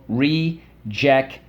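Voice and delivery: rejection slowly split as re... jec... tion; syllable by syllable. Shown as a demonstration. Teacher leading the class through rejection, syllable by syllable. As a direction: say rejection in separate, drawn-out beats. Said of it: "Let's go".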